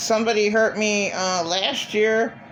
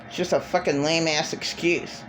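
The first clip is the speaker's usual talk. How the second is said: normal talking voice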